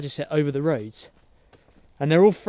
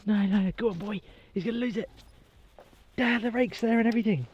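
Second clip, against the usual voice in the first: usual speaking voice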